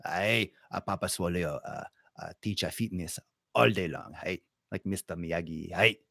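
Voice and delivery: put-on low, soft wise old master; sensei voice. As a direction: play a calm Mister Miyagi type. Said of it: "Should I just do voices?".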